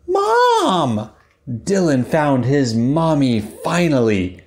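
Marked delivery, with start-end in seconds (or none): 0.1-1.1 very high, overjoyed call; joyful cry. Acted. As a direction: shout with relief and joy. Dylan finds his mother.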